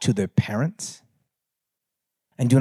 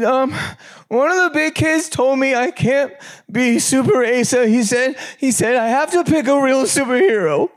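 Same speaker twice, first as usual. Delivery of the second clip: put-on high, small child's voice, pitched way up; little son's voice; 10 semitones higher than usual. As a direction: speak as a disappointed four-year-old boy. Father voices his young son.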